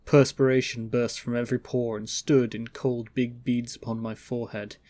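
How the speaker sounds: plain reading voice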